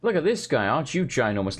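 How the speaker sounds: normal talking voice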